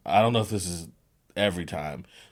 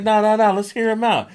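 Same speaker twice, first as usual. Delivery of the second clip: eager insisting; 13 semitones higher, 9 dB louder than usual. Retold or imitated. Eager to hear the pitch.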